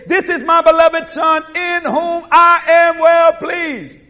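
God declaring it solemnly from above; voice from heaven. Acted. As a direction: proclaim it slowly, holding each word. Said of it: "a voice from heaven saying".

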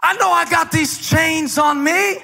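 impassioned preaching. Acted. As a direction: preach it out as triumphant testimony. Preacher testifying at high intensity.

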